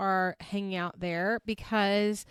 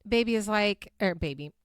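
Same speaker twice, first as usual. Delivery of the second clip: ordinary delivery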